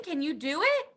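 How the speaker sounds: usual speaking voice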